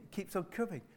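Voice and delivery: usual speaking voice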